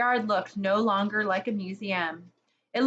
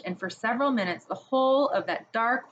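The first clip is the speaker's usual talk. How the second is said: usual speaking voice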